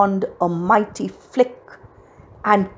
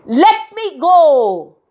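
high, loud, drawn-out yell; slow, full-voiced high cry; desperate shout; 7 semitones higher, 5 dB louder, slower than usual. A narrator's reading. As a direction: shout to break free. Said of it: "as Cindy shouted".

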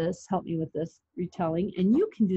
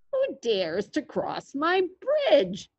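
slow troll challenge, swooping high; drawn-out troll voice with big pitch swings; growling troll; 6 semitones higher, slower than usual. A narrator's reading. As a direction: growl it as a mean old storybook troll. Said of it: "growled the mean old troll".

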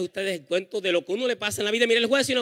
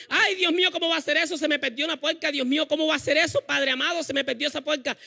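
mother wailing; 5 semitones higher than usual. Retold or imitated. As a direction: lament in a higher mother's voice. Y dice mami. (la mamá se lamenta en voz aguda)

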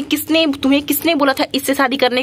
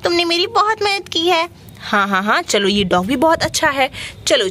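normal talking voice

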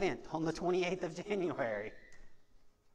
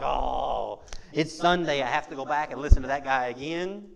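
mock reluctance; 6 semitones lower, 6 dB louder than usual. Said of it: "It should not be something like".

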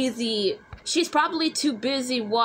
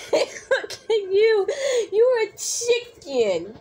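high, slowed teasing through laughter; laughing taunt; 7 semitones higher than usual. Acted. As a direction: tease your friend, giggling as you speak. Mocking friend while laughing.

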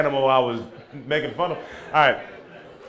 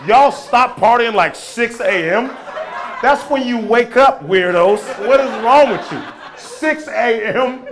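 higher, louder outraged disbelief; playful shocked scolding; incredulous; 5 semitones higher, 6 dB louder than usual. Real, not acted. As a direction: act amazed they party so late. Incredulous rant at crowd.

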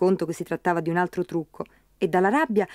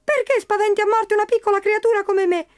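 high squeaky little creature crying out; tiny frightened voice, much higher; crying leprechaun; 13 semitones higher, 3 dB louder than usual. Acted. Leprechaun crying out to Pat in fright, high squeaky voice. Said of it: "gridò il leprecano".

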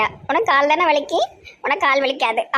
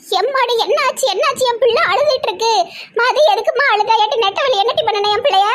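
higher-pitched, fussing mother voice; alarmed doting mother; 10 semitones higher, 4 dB louder than usual. Acted. Mother demands to know who made her daughter cry, alarmed. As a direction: wail in worry over your child.

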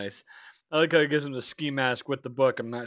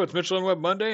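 higher, lilting show opener; sing-song announcer intro; 8 semitones higher, 3 dB louder, faster than usual. Acted.

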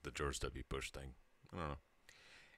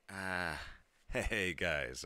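startled, louder laughing hey guys; nervous placating; 4 semitones higher, 4 dB louder, much slower than usual. Retold or imitated. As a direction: defuse it fast with a nervous laugh. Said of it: "And I'm like".

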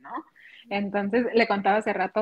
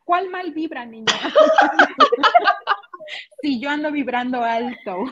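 louder, indignant question; indignant retort; 5 semitones higher, 6 dB louder than usual. Retold or imitated. Mother fires back at her child about bad vibes, indignant. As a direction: fire back in mock offence. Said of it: "Y yo".

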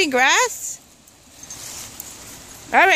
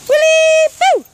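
calling out; 10 semitones higher, 7 dB louder than usual. Real, not acted. Calling out to pets outdoors.

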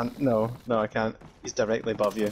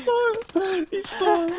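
alarmed; 5 dB louder than usual. Real, not acted. Partner panics at being seen.

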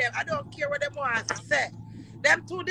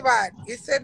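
ordinary delivery

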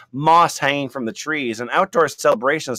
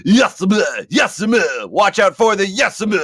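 high, loud mock witch voice; hammed-up witch impression; imitating the witch; 7 semitones higher, 4 dB louder, slower than usual. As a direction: cackle it like the old witch. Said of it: "She was always telling me".

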